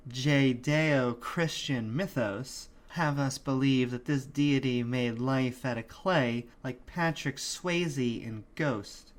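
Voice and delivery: ordinary talk, nothing marked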